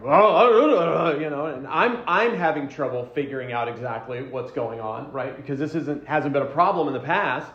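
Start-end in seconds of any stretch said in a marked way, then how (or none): 0.0-1.2 a louder, slow, lightly voiced flustered husband; flustered reply. Husband answering his wife's question, a loud flustered shrug. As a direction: blurt it out, flustered and lost. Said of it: "and it's like".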